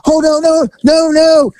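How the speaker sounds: high, loud panicked no's; mock panic. Client fleeing the masseuse, high mock panic. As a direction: back away in a hammed panic.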